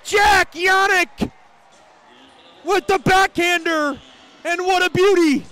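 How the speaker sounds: high, loud celebration yelling; joyful goal shout. Real, not acted. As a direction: roar the celebration to the crowd.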